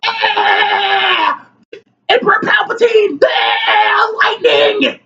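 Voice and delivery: put-on high shrieking parody of a raging girl; mock demonic screaming. Vlogger imitates a girl screaming at a preacher, shrieking high. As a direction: screech it like a cartoon demon. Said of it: "got in his face and was like".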